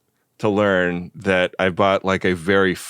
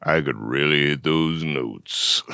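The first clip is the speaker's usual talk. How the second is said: lower, slow crooner voice; 5 semitones lower than usual. Pretend. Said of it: "Whoa! Do it again!".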